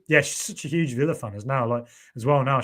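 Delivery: ordinary talk, nothing marked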